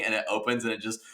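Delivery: normal talking voice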